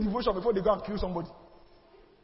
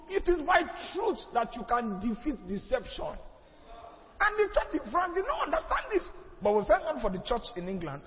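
ordinary talk

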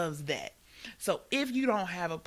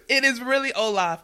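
laughing; 6 semitones higher, 10 dB louder than usual. Real, not acted. Laughing agreement about Olaf.

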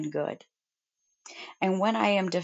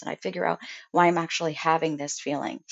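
ordinary delivery

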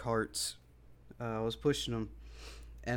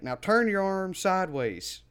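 loud, slow, higher instruction; retold dog owner; 7 semitones higher, 5 dB louder than usual. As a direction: lightly imitate a stranger giving orders. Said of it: "And then he's like".

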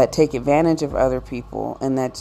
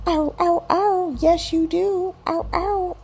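very high yelping dog voice; talking dog. Acted. Fido argues back that owner has the bone, high yelping. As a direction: yip the words like a dog. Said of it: "This is what Fido's saying in his brain".

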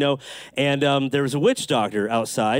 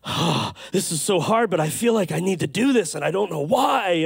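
breath out, then higher strained imitation; reluctant girl; 3 semitones higher than usual. Preacher imitating the girl facing a hard choice, mock anguish. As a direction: put on a girl struggling to agree. Acts out girl's struggling reply.

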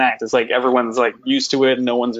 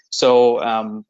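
usual speaking voice

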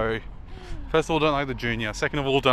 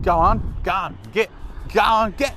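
lightly voiced Australian "go on, get", quick and dismissive; a hint of an Aussie shooing someone off; 6 semitones higher than usual. Shown as a demonstration. Showing how Australians say it, in a light Aussie accent. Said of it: "People say, say that a lot".

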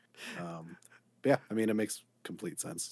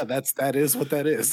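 higher and louder, hammed-up matter-of-fact; emphatic agreement; 6 semitones higher, 8 dB louder than usual. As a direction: land it like an obvious truth, playfully. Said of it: "I'm like".

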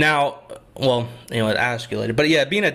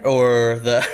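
laughing; 3 semitones lower, 3 dB louder than usual. Real, not acted. Laughing through tears story.

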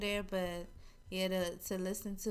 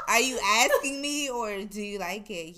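louder, laughing question; 10 dB louder than usual. Real, not acted. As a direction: ask it while cracking up. Laughing retort.

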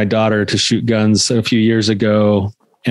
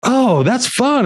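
much higher, slow, delighted; delighted kid; 13 semitones higher, slower than usual. Retold or imitated. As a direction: gush like a surprised little girl. Daughter delighted, voiced high.

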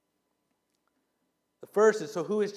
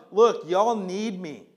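put-on slow, emphatic God with a light southern accent; drawn-out, lightly southern plea from God; 5 dB louder, slower than usual. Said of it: "God will have a southern accent".

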